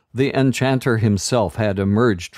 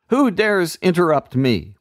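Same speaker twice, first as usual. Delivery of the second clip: much higher, imperious demand; angry wizard; 9 semitones higher than usual. A narrator's reading. Enchanter demanding who interrupted, imperious. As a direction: challenge the whole room.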